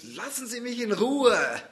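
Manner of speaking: high, loud desperate yell; yelled at the driver. Retold or imitated. Frightened woman yells at the trucker to leave her alone, desperate shout. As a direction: scream at him to back off. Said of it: "den L-K-W-Fahrer angebrüllt".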